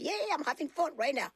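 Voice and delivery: put-on high gleeful kid voice; carefree child. Little girl enjoying herself on stage, high and gleeful. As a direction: toss it off like a happy little girl. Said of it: "I'm like".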